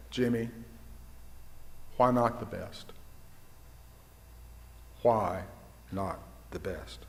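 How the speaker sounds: low, soft, with long pauses; hushed retold admiral, pausing between phrases; grave quiet question. Admiral's question after long silence.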